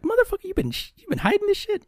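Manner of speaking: shrieking mock outrage. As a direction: squeak it out like a scandalized kid. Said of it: "I'm like".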